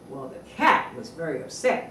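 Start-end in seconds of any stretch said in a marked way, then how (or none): none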